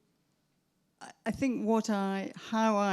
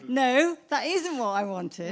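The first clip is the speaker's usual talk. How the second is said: louder, higher, drawn-out "No"; playful mock protest; 6 semitones higher, 6 dB louder than usual. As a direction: jokingly voice a dissatisfied questioner. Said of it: "and she's like".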